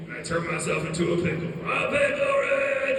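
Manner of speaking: hammed-up catchphrase, swooping up at the end; Rick. Brother quotes cartoon catchphrase, loud Rick voice. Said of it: "Rick from Rick and Morty voice".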